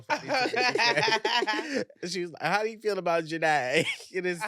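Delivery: high-pitched, giggly retelling; real laughing. Real, not acted. Co-host explains her sister's name is Janae, laughing. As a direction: laugh your way through the quote. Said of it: "I cannot stop laughing right now".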